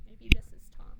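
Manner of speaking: breathy whispered guess; hushed aside to the class. Real, not acted. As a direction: lean in and whisper it.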